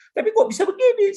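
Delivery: high, loud, quick protest; complaining. Retold or imitated. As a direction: complain upward in exasperated disbelief. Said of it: "ngomong saya aja seperti komplain". (mengeluh dengan nada tinggi dan cepat)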